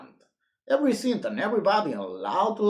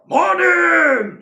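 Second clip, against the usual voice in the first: high, loud shout; shouted morning; 13 semitones higher, 11 dB louder than usual. Acted. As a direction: bellow the greeting. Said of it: "shouted the postman".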